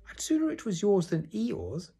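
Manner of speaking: high little piglet voice. A narrator's reading. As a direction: squeak it like a small loyal friend. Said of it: "said Piglet".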